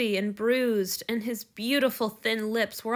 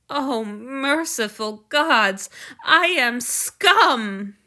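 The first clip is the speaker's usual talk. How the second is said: very slow, loud, despairing; anguished self-loathing; 2 semitones higher, 7 dB louder, much slower than usual. Acted. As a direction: wail your own guilt. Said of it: "in a fit of pique... petty and selfish".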